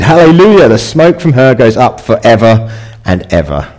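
high, loud exultant shout; shouted hallelujah. Retold or imitated. Preacher voicing heaven's crowd, loud exultant shout. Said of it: "they shouted".

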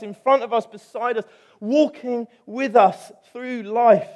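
loud, high, ringing pulpit voice; preaching at full force. Acted. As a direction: proclaim it to the whole church.

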